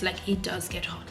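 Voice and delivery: breathy whispered aside. Real, not acted. Whispering to viewers that it gets hot. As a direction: drop to a whisper for emphasis. Repeats the claim under her breath.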